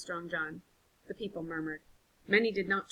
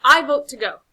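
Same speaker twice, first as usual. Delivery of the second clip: loud vote; 6 semitones higher, 17 dB louder than usual. Acted. Casting vote in council.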